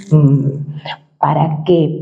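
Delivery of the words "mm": short low "mmm"; lowered hum. Shown as a demonstration. Coach showing how to lower tone, lowered hum. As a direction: bring the cow-like hum down low. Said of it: "intentar elevar o bajar".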